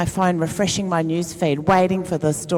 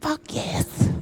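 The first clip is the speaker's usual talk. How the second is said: breathy triumphant whisper; a quiet, gleeful fuck yes; 7 semitones higher, 3 dB quieter than usual. Retold or imitated. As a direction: hiss it out in secret delight. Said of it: "And I went".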